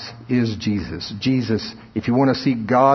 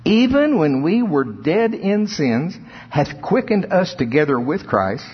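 rising, drawn-out pulpit reading of scripture, much higher and slow on the climax; preacher lifting the verse higher and slower; preaching scripture reading; 6 semitones higher than usual. Acted. Preacher reading Ephesians to the congregation in slow, soaring cadence.

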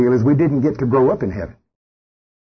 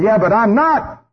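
firm reply; 10 semitones higher, slower than usual. Acted. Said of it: "And you're going".

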